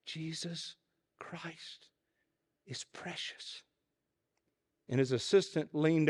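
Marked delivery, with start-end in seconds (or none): 0.0-3.6 faint unvoiced whisper; frail old preacher whispering it again. A narrator's reading. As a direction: breathe it out, almost gone. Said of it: "And then he said it again".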